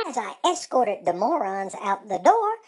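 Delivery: ordinary delivery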